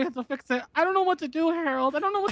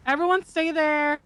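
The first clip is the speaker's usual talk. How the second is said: ordinary delivery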